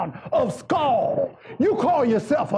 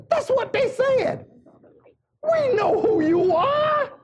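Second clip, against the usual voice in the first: high, stretched pulpit cry; full-force preaching; 12 semitones higher than usual. Acted. Preacher voicing devils who question Jesus, full-force preaching. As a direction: call out each phrase high and separate.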